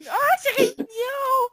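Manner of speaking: cooing. Real, not acted. Cooing at cute pants.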